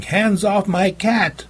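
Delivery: high, slow protective cry; yelling. A narrator's reading. Girl yelling at the man grabbing her cat. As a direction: yell to save her cat.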